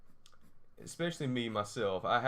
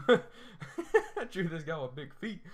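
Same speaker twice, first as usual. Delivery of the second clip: slow, laughing repeat, higher and louder; 6 semitones higher, 5 dB louder, slower than usual. Shown as a demonstration. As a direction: say it again through a laugh. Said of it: "And I drew this guy with big feet".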